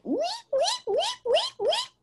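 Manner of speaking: very high squeaky guinea pig wheeks. Retold or imitated. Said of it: "making the guinea pig noise".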